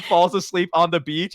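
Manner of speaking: usual speaking voice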